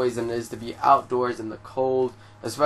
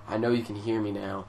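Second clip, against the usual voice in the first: retold low murmur, much softer; quiet creepy murmur; 3 semitones lower, 7 dB quieter than usual. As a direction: mimic the stranger murmuring softly across the train. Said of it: "and he's saying".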